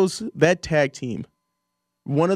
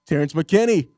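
normal talking voice

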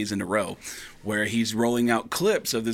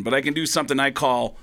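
usual speaking voice

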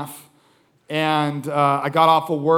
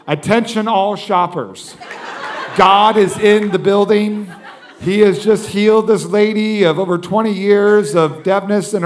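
higher, projected store announcer delivery; loud, raised public announcement, retold; intercom announcement; 4 semitones higher, 5 dB louder than usual. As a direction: address the whole store over the intercom. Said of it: "Can I get on the intercom?".

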